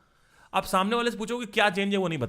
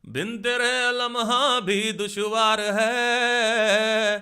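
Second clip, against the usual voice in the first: slow sung line, louder, in [name]'s style; 4 semitones higher, 5 dB louder, much slower than usual. Shown as a demonstration. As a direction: sing like [name]. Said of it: "[name] की तरह".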